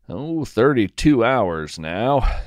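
plain reading voice

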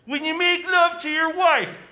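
preachy public-health warning, hammed up; Doctor Fauci impression. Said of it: "This is Doctor Fauci".

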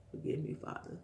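hushed plea to God; softly begging forgiveness from God; whispered prayer. Real, not acted. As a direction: whisper it like a confession. Praying to God.

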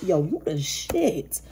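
slow, savoured street praise; slow drawn-out hype. Pretend. Reader slowly hyping up Virgo listener. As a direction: stretch it out, relishing each word.

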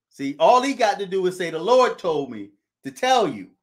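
normal talking voice

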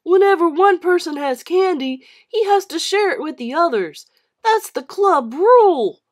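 loud, high bossy voice; schoolboy bully inventing a club rule; bully laying down rules. Acted. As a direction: lay down the law.

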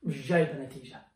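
normal talking voice

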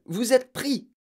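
high, excited declaration; triumphant challenge. A narrator's reading. Matilda cornering supposed burglars, triumphant. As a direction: announce the capture with force.

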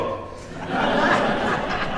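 breathy high-pitched laugh; real laugh. Real, not acted. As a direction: burst out laughing. Laughs at his own story.

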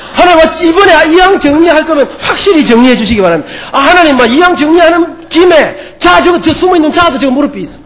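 half-joking, beg God to finish them all off; hammed-up indignant prayer; mock demanding prayer. Preacher acts his angry prayer.

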